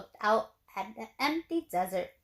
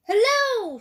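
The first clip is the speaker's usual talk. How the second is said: much higher, louder shouted hello; 10 semitones higher, 8 dB louder than usual. A narrator's reading. Tortoise shouting hello at Hank, high and loud. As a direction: shout a greeting across the yard. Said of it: "shouted a tortoise".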